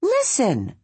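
a little creature urging, listen; urging critter. A narrator's reading. Bongo to the tree, a high urgent small-animal voice. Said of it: "Bongo urged".

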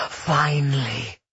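slow, drawn-out otherworldly voice; inner water spirit. A narrator's reading. Water kindred speaks inside Cleo, slow and otherworldly. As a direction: draw it out as a spirit within. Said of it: "the water kindred said from within her".

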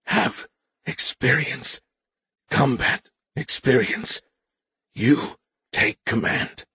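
slow, pained and strained. A narrator's reading. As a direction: push through a wave of pain. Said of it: "as a wave of pain struck him".

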